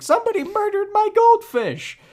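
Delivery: hammed-up, high-pitched mock scream; imitated scream. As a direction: cry out very high and loud, imitating Mandy's alarm. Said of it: "and screaming".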